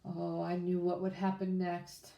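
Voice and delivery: ordinary delivery, nothing marked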